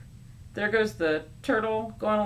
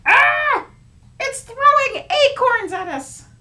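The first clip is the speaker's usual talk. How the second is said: loud, high alarmed cry; much higher, louder startled shout; 14 dB louder, slower than usual. Acted. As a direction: yelp as acorns fly. Acorns thrown at them.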